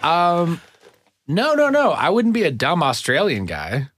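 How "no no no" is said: high, insistent protest; emphatic no. Real, not acted. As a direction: shoot it down up high. Quick denial of past life.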